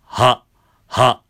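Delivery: loud ha with a firm glottal cut; voiced ha with stops. Shown as a demonstration. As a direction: voice the ha and clamp it shut. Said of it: "でも最後に".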